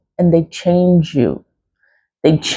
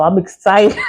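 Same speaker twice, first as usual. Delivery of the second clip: ordinary talk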